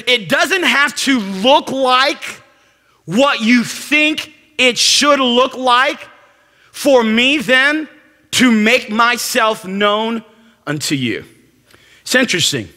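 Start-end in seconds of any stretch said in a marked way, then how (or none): 0.1-11.2 slow, higher, measured God's voice; lightly voiced patient God; slow, deliberate. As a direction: spell it out gently, word by word. Speaking as God to Elijah.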